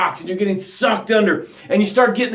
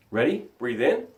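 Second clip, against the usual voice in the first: guided breathing; 7 semitones lower, 14 dB quieter than usual. Acted. Coach leading the tribe through four breaths, soft guided cues. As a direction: guide the room calmly through each breath.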